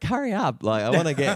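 impatient quote. Retold or imitated. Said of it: "I was like".

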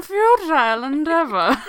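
high, loud mock-grand voice; character voice. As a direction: echo the silly character, exaggerating. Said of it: "in a character voice".

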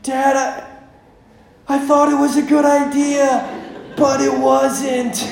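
high, slow mock-crying kid; whimpering little-kid impression; crying child. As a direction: imitate your tearful kid owning up. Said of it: "he's just crying".

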